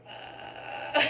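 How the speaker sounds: imitated creaky vocal fry grunt. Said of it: "He literally went".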